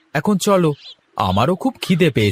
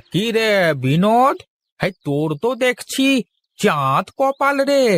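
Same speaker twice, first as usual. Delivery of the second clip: playful teasing; 8 semitones higher than usual. Acted. Teasing neighbour about good harvest.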